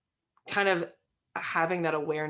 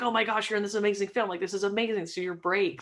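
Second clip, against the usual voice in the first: fast, higher-pitched excited gushing; pumped up; 5 semitones higher than usual. Retold or imitated. Guest reacting to a friend's film news, pumped up. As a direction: retell being thrilled for a friend. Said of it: "so pumped up".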